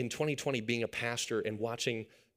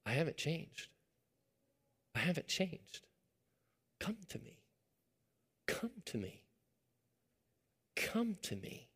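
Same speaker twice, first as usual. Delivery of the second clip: soft, higher, hushed invitation; much softer tender pleading as God; coaxing call; 5 semitones higher, 8 dB quieter than usual. Acted. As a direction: gently call someone home, almost a whisper. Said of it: "he's coaxing you and he's calling you".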